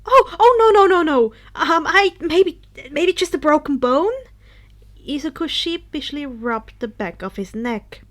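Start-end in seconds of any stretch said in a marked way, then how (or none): 0.0-4.1 loud, higher, tumbling no's; flustered denial. Acted. Izuku flustered when Denki asks about his arm. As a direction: scramble to deny, embarrassed.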